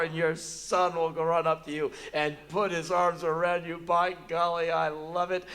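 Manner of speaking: a touch of hammed-up wonder; lightly overdone delight; mock rapture. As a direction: gush like a newly converted child-lover. Gushing over joy of children.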